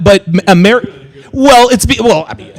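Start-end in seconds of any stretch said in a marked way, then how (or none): none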